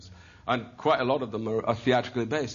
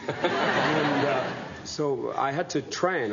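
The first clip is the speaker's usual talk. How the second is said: chuckling through the words, slowed; laughing; 3 semitones higher, 4 dB louder, much slower than usual. Real, not acted. Actor laughing as he tells the host about training. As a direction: laugh it out slowly, amused at himself. Laughing while explaining his roots.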